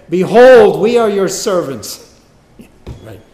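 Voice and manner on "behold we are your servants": higher, booming cry of submission; loud proclamation. Acted. Brothers fallen down before Joseph, loud hammed-up declaration. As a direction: cry it out with a huge first word.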